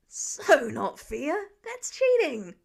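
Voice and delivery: put-on whiny kid protest. A narrator's reading. Boy protests Peter climbing the porch, loud whine. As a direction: wail the unfairness like a cheated kid.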